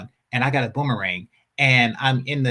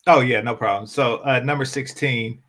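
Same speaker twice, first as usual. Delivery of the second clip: ordinary delivery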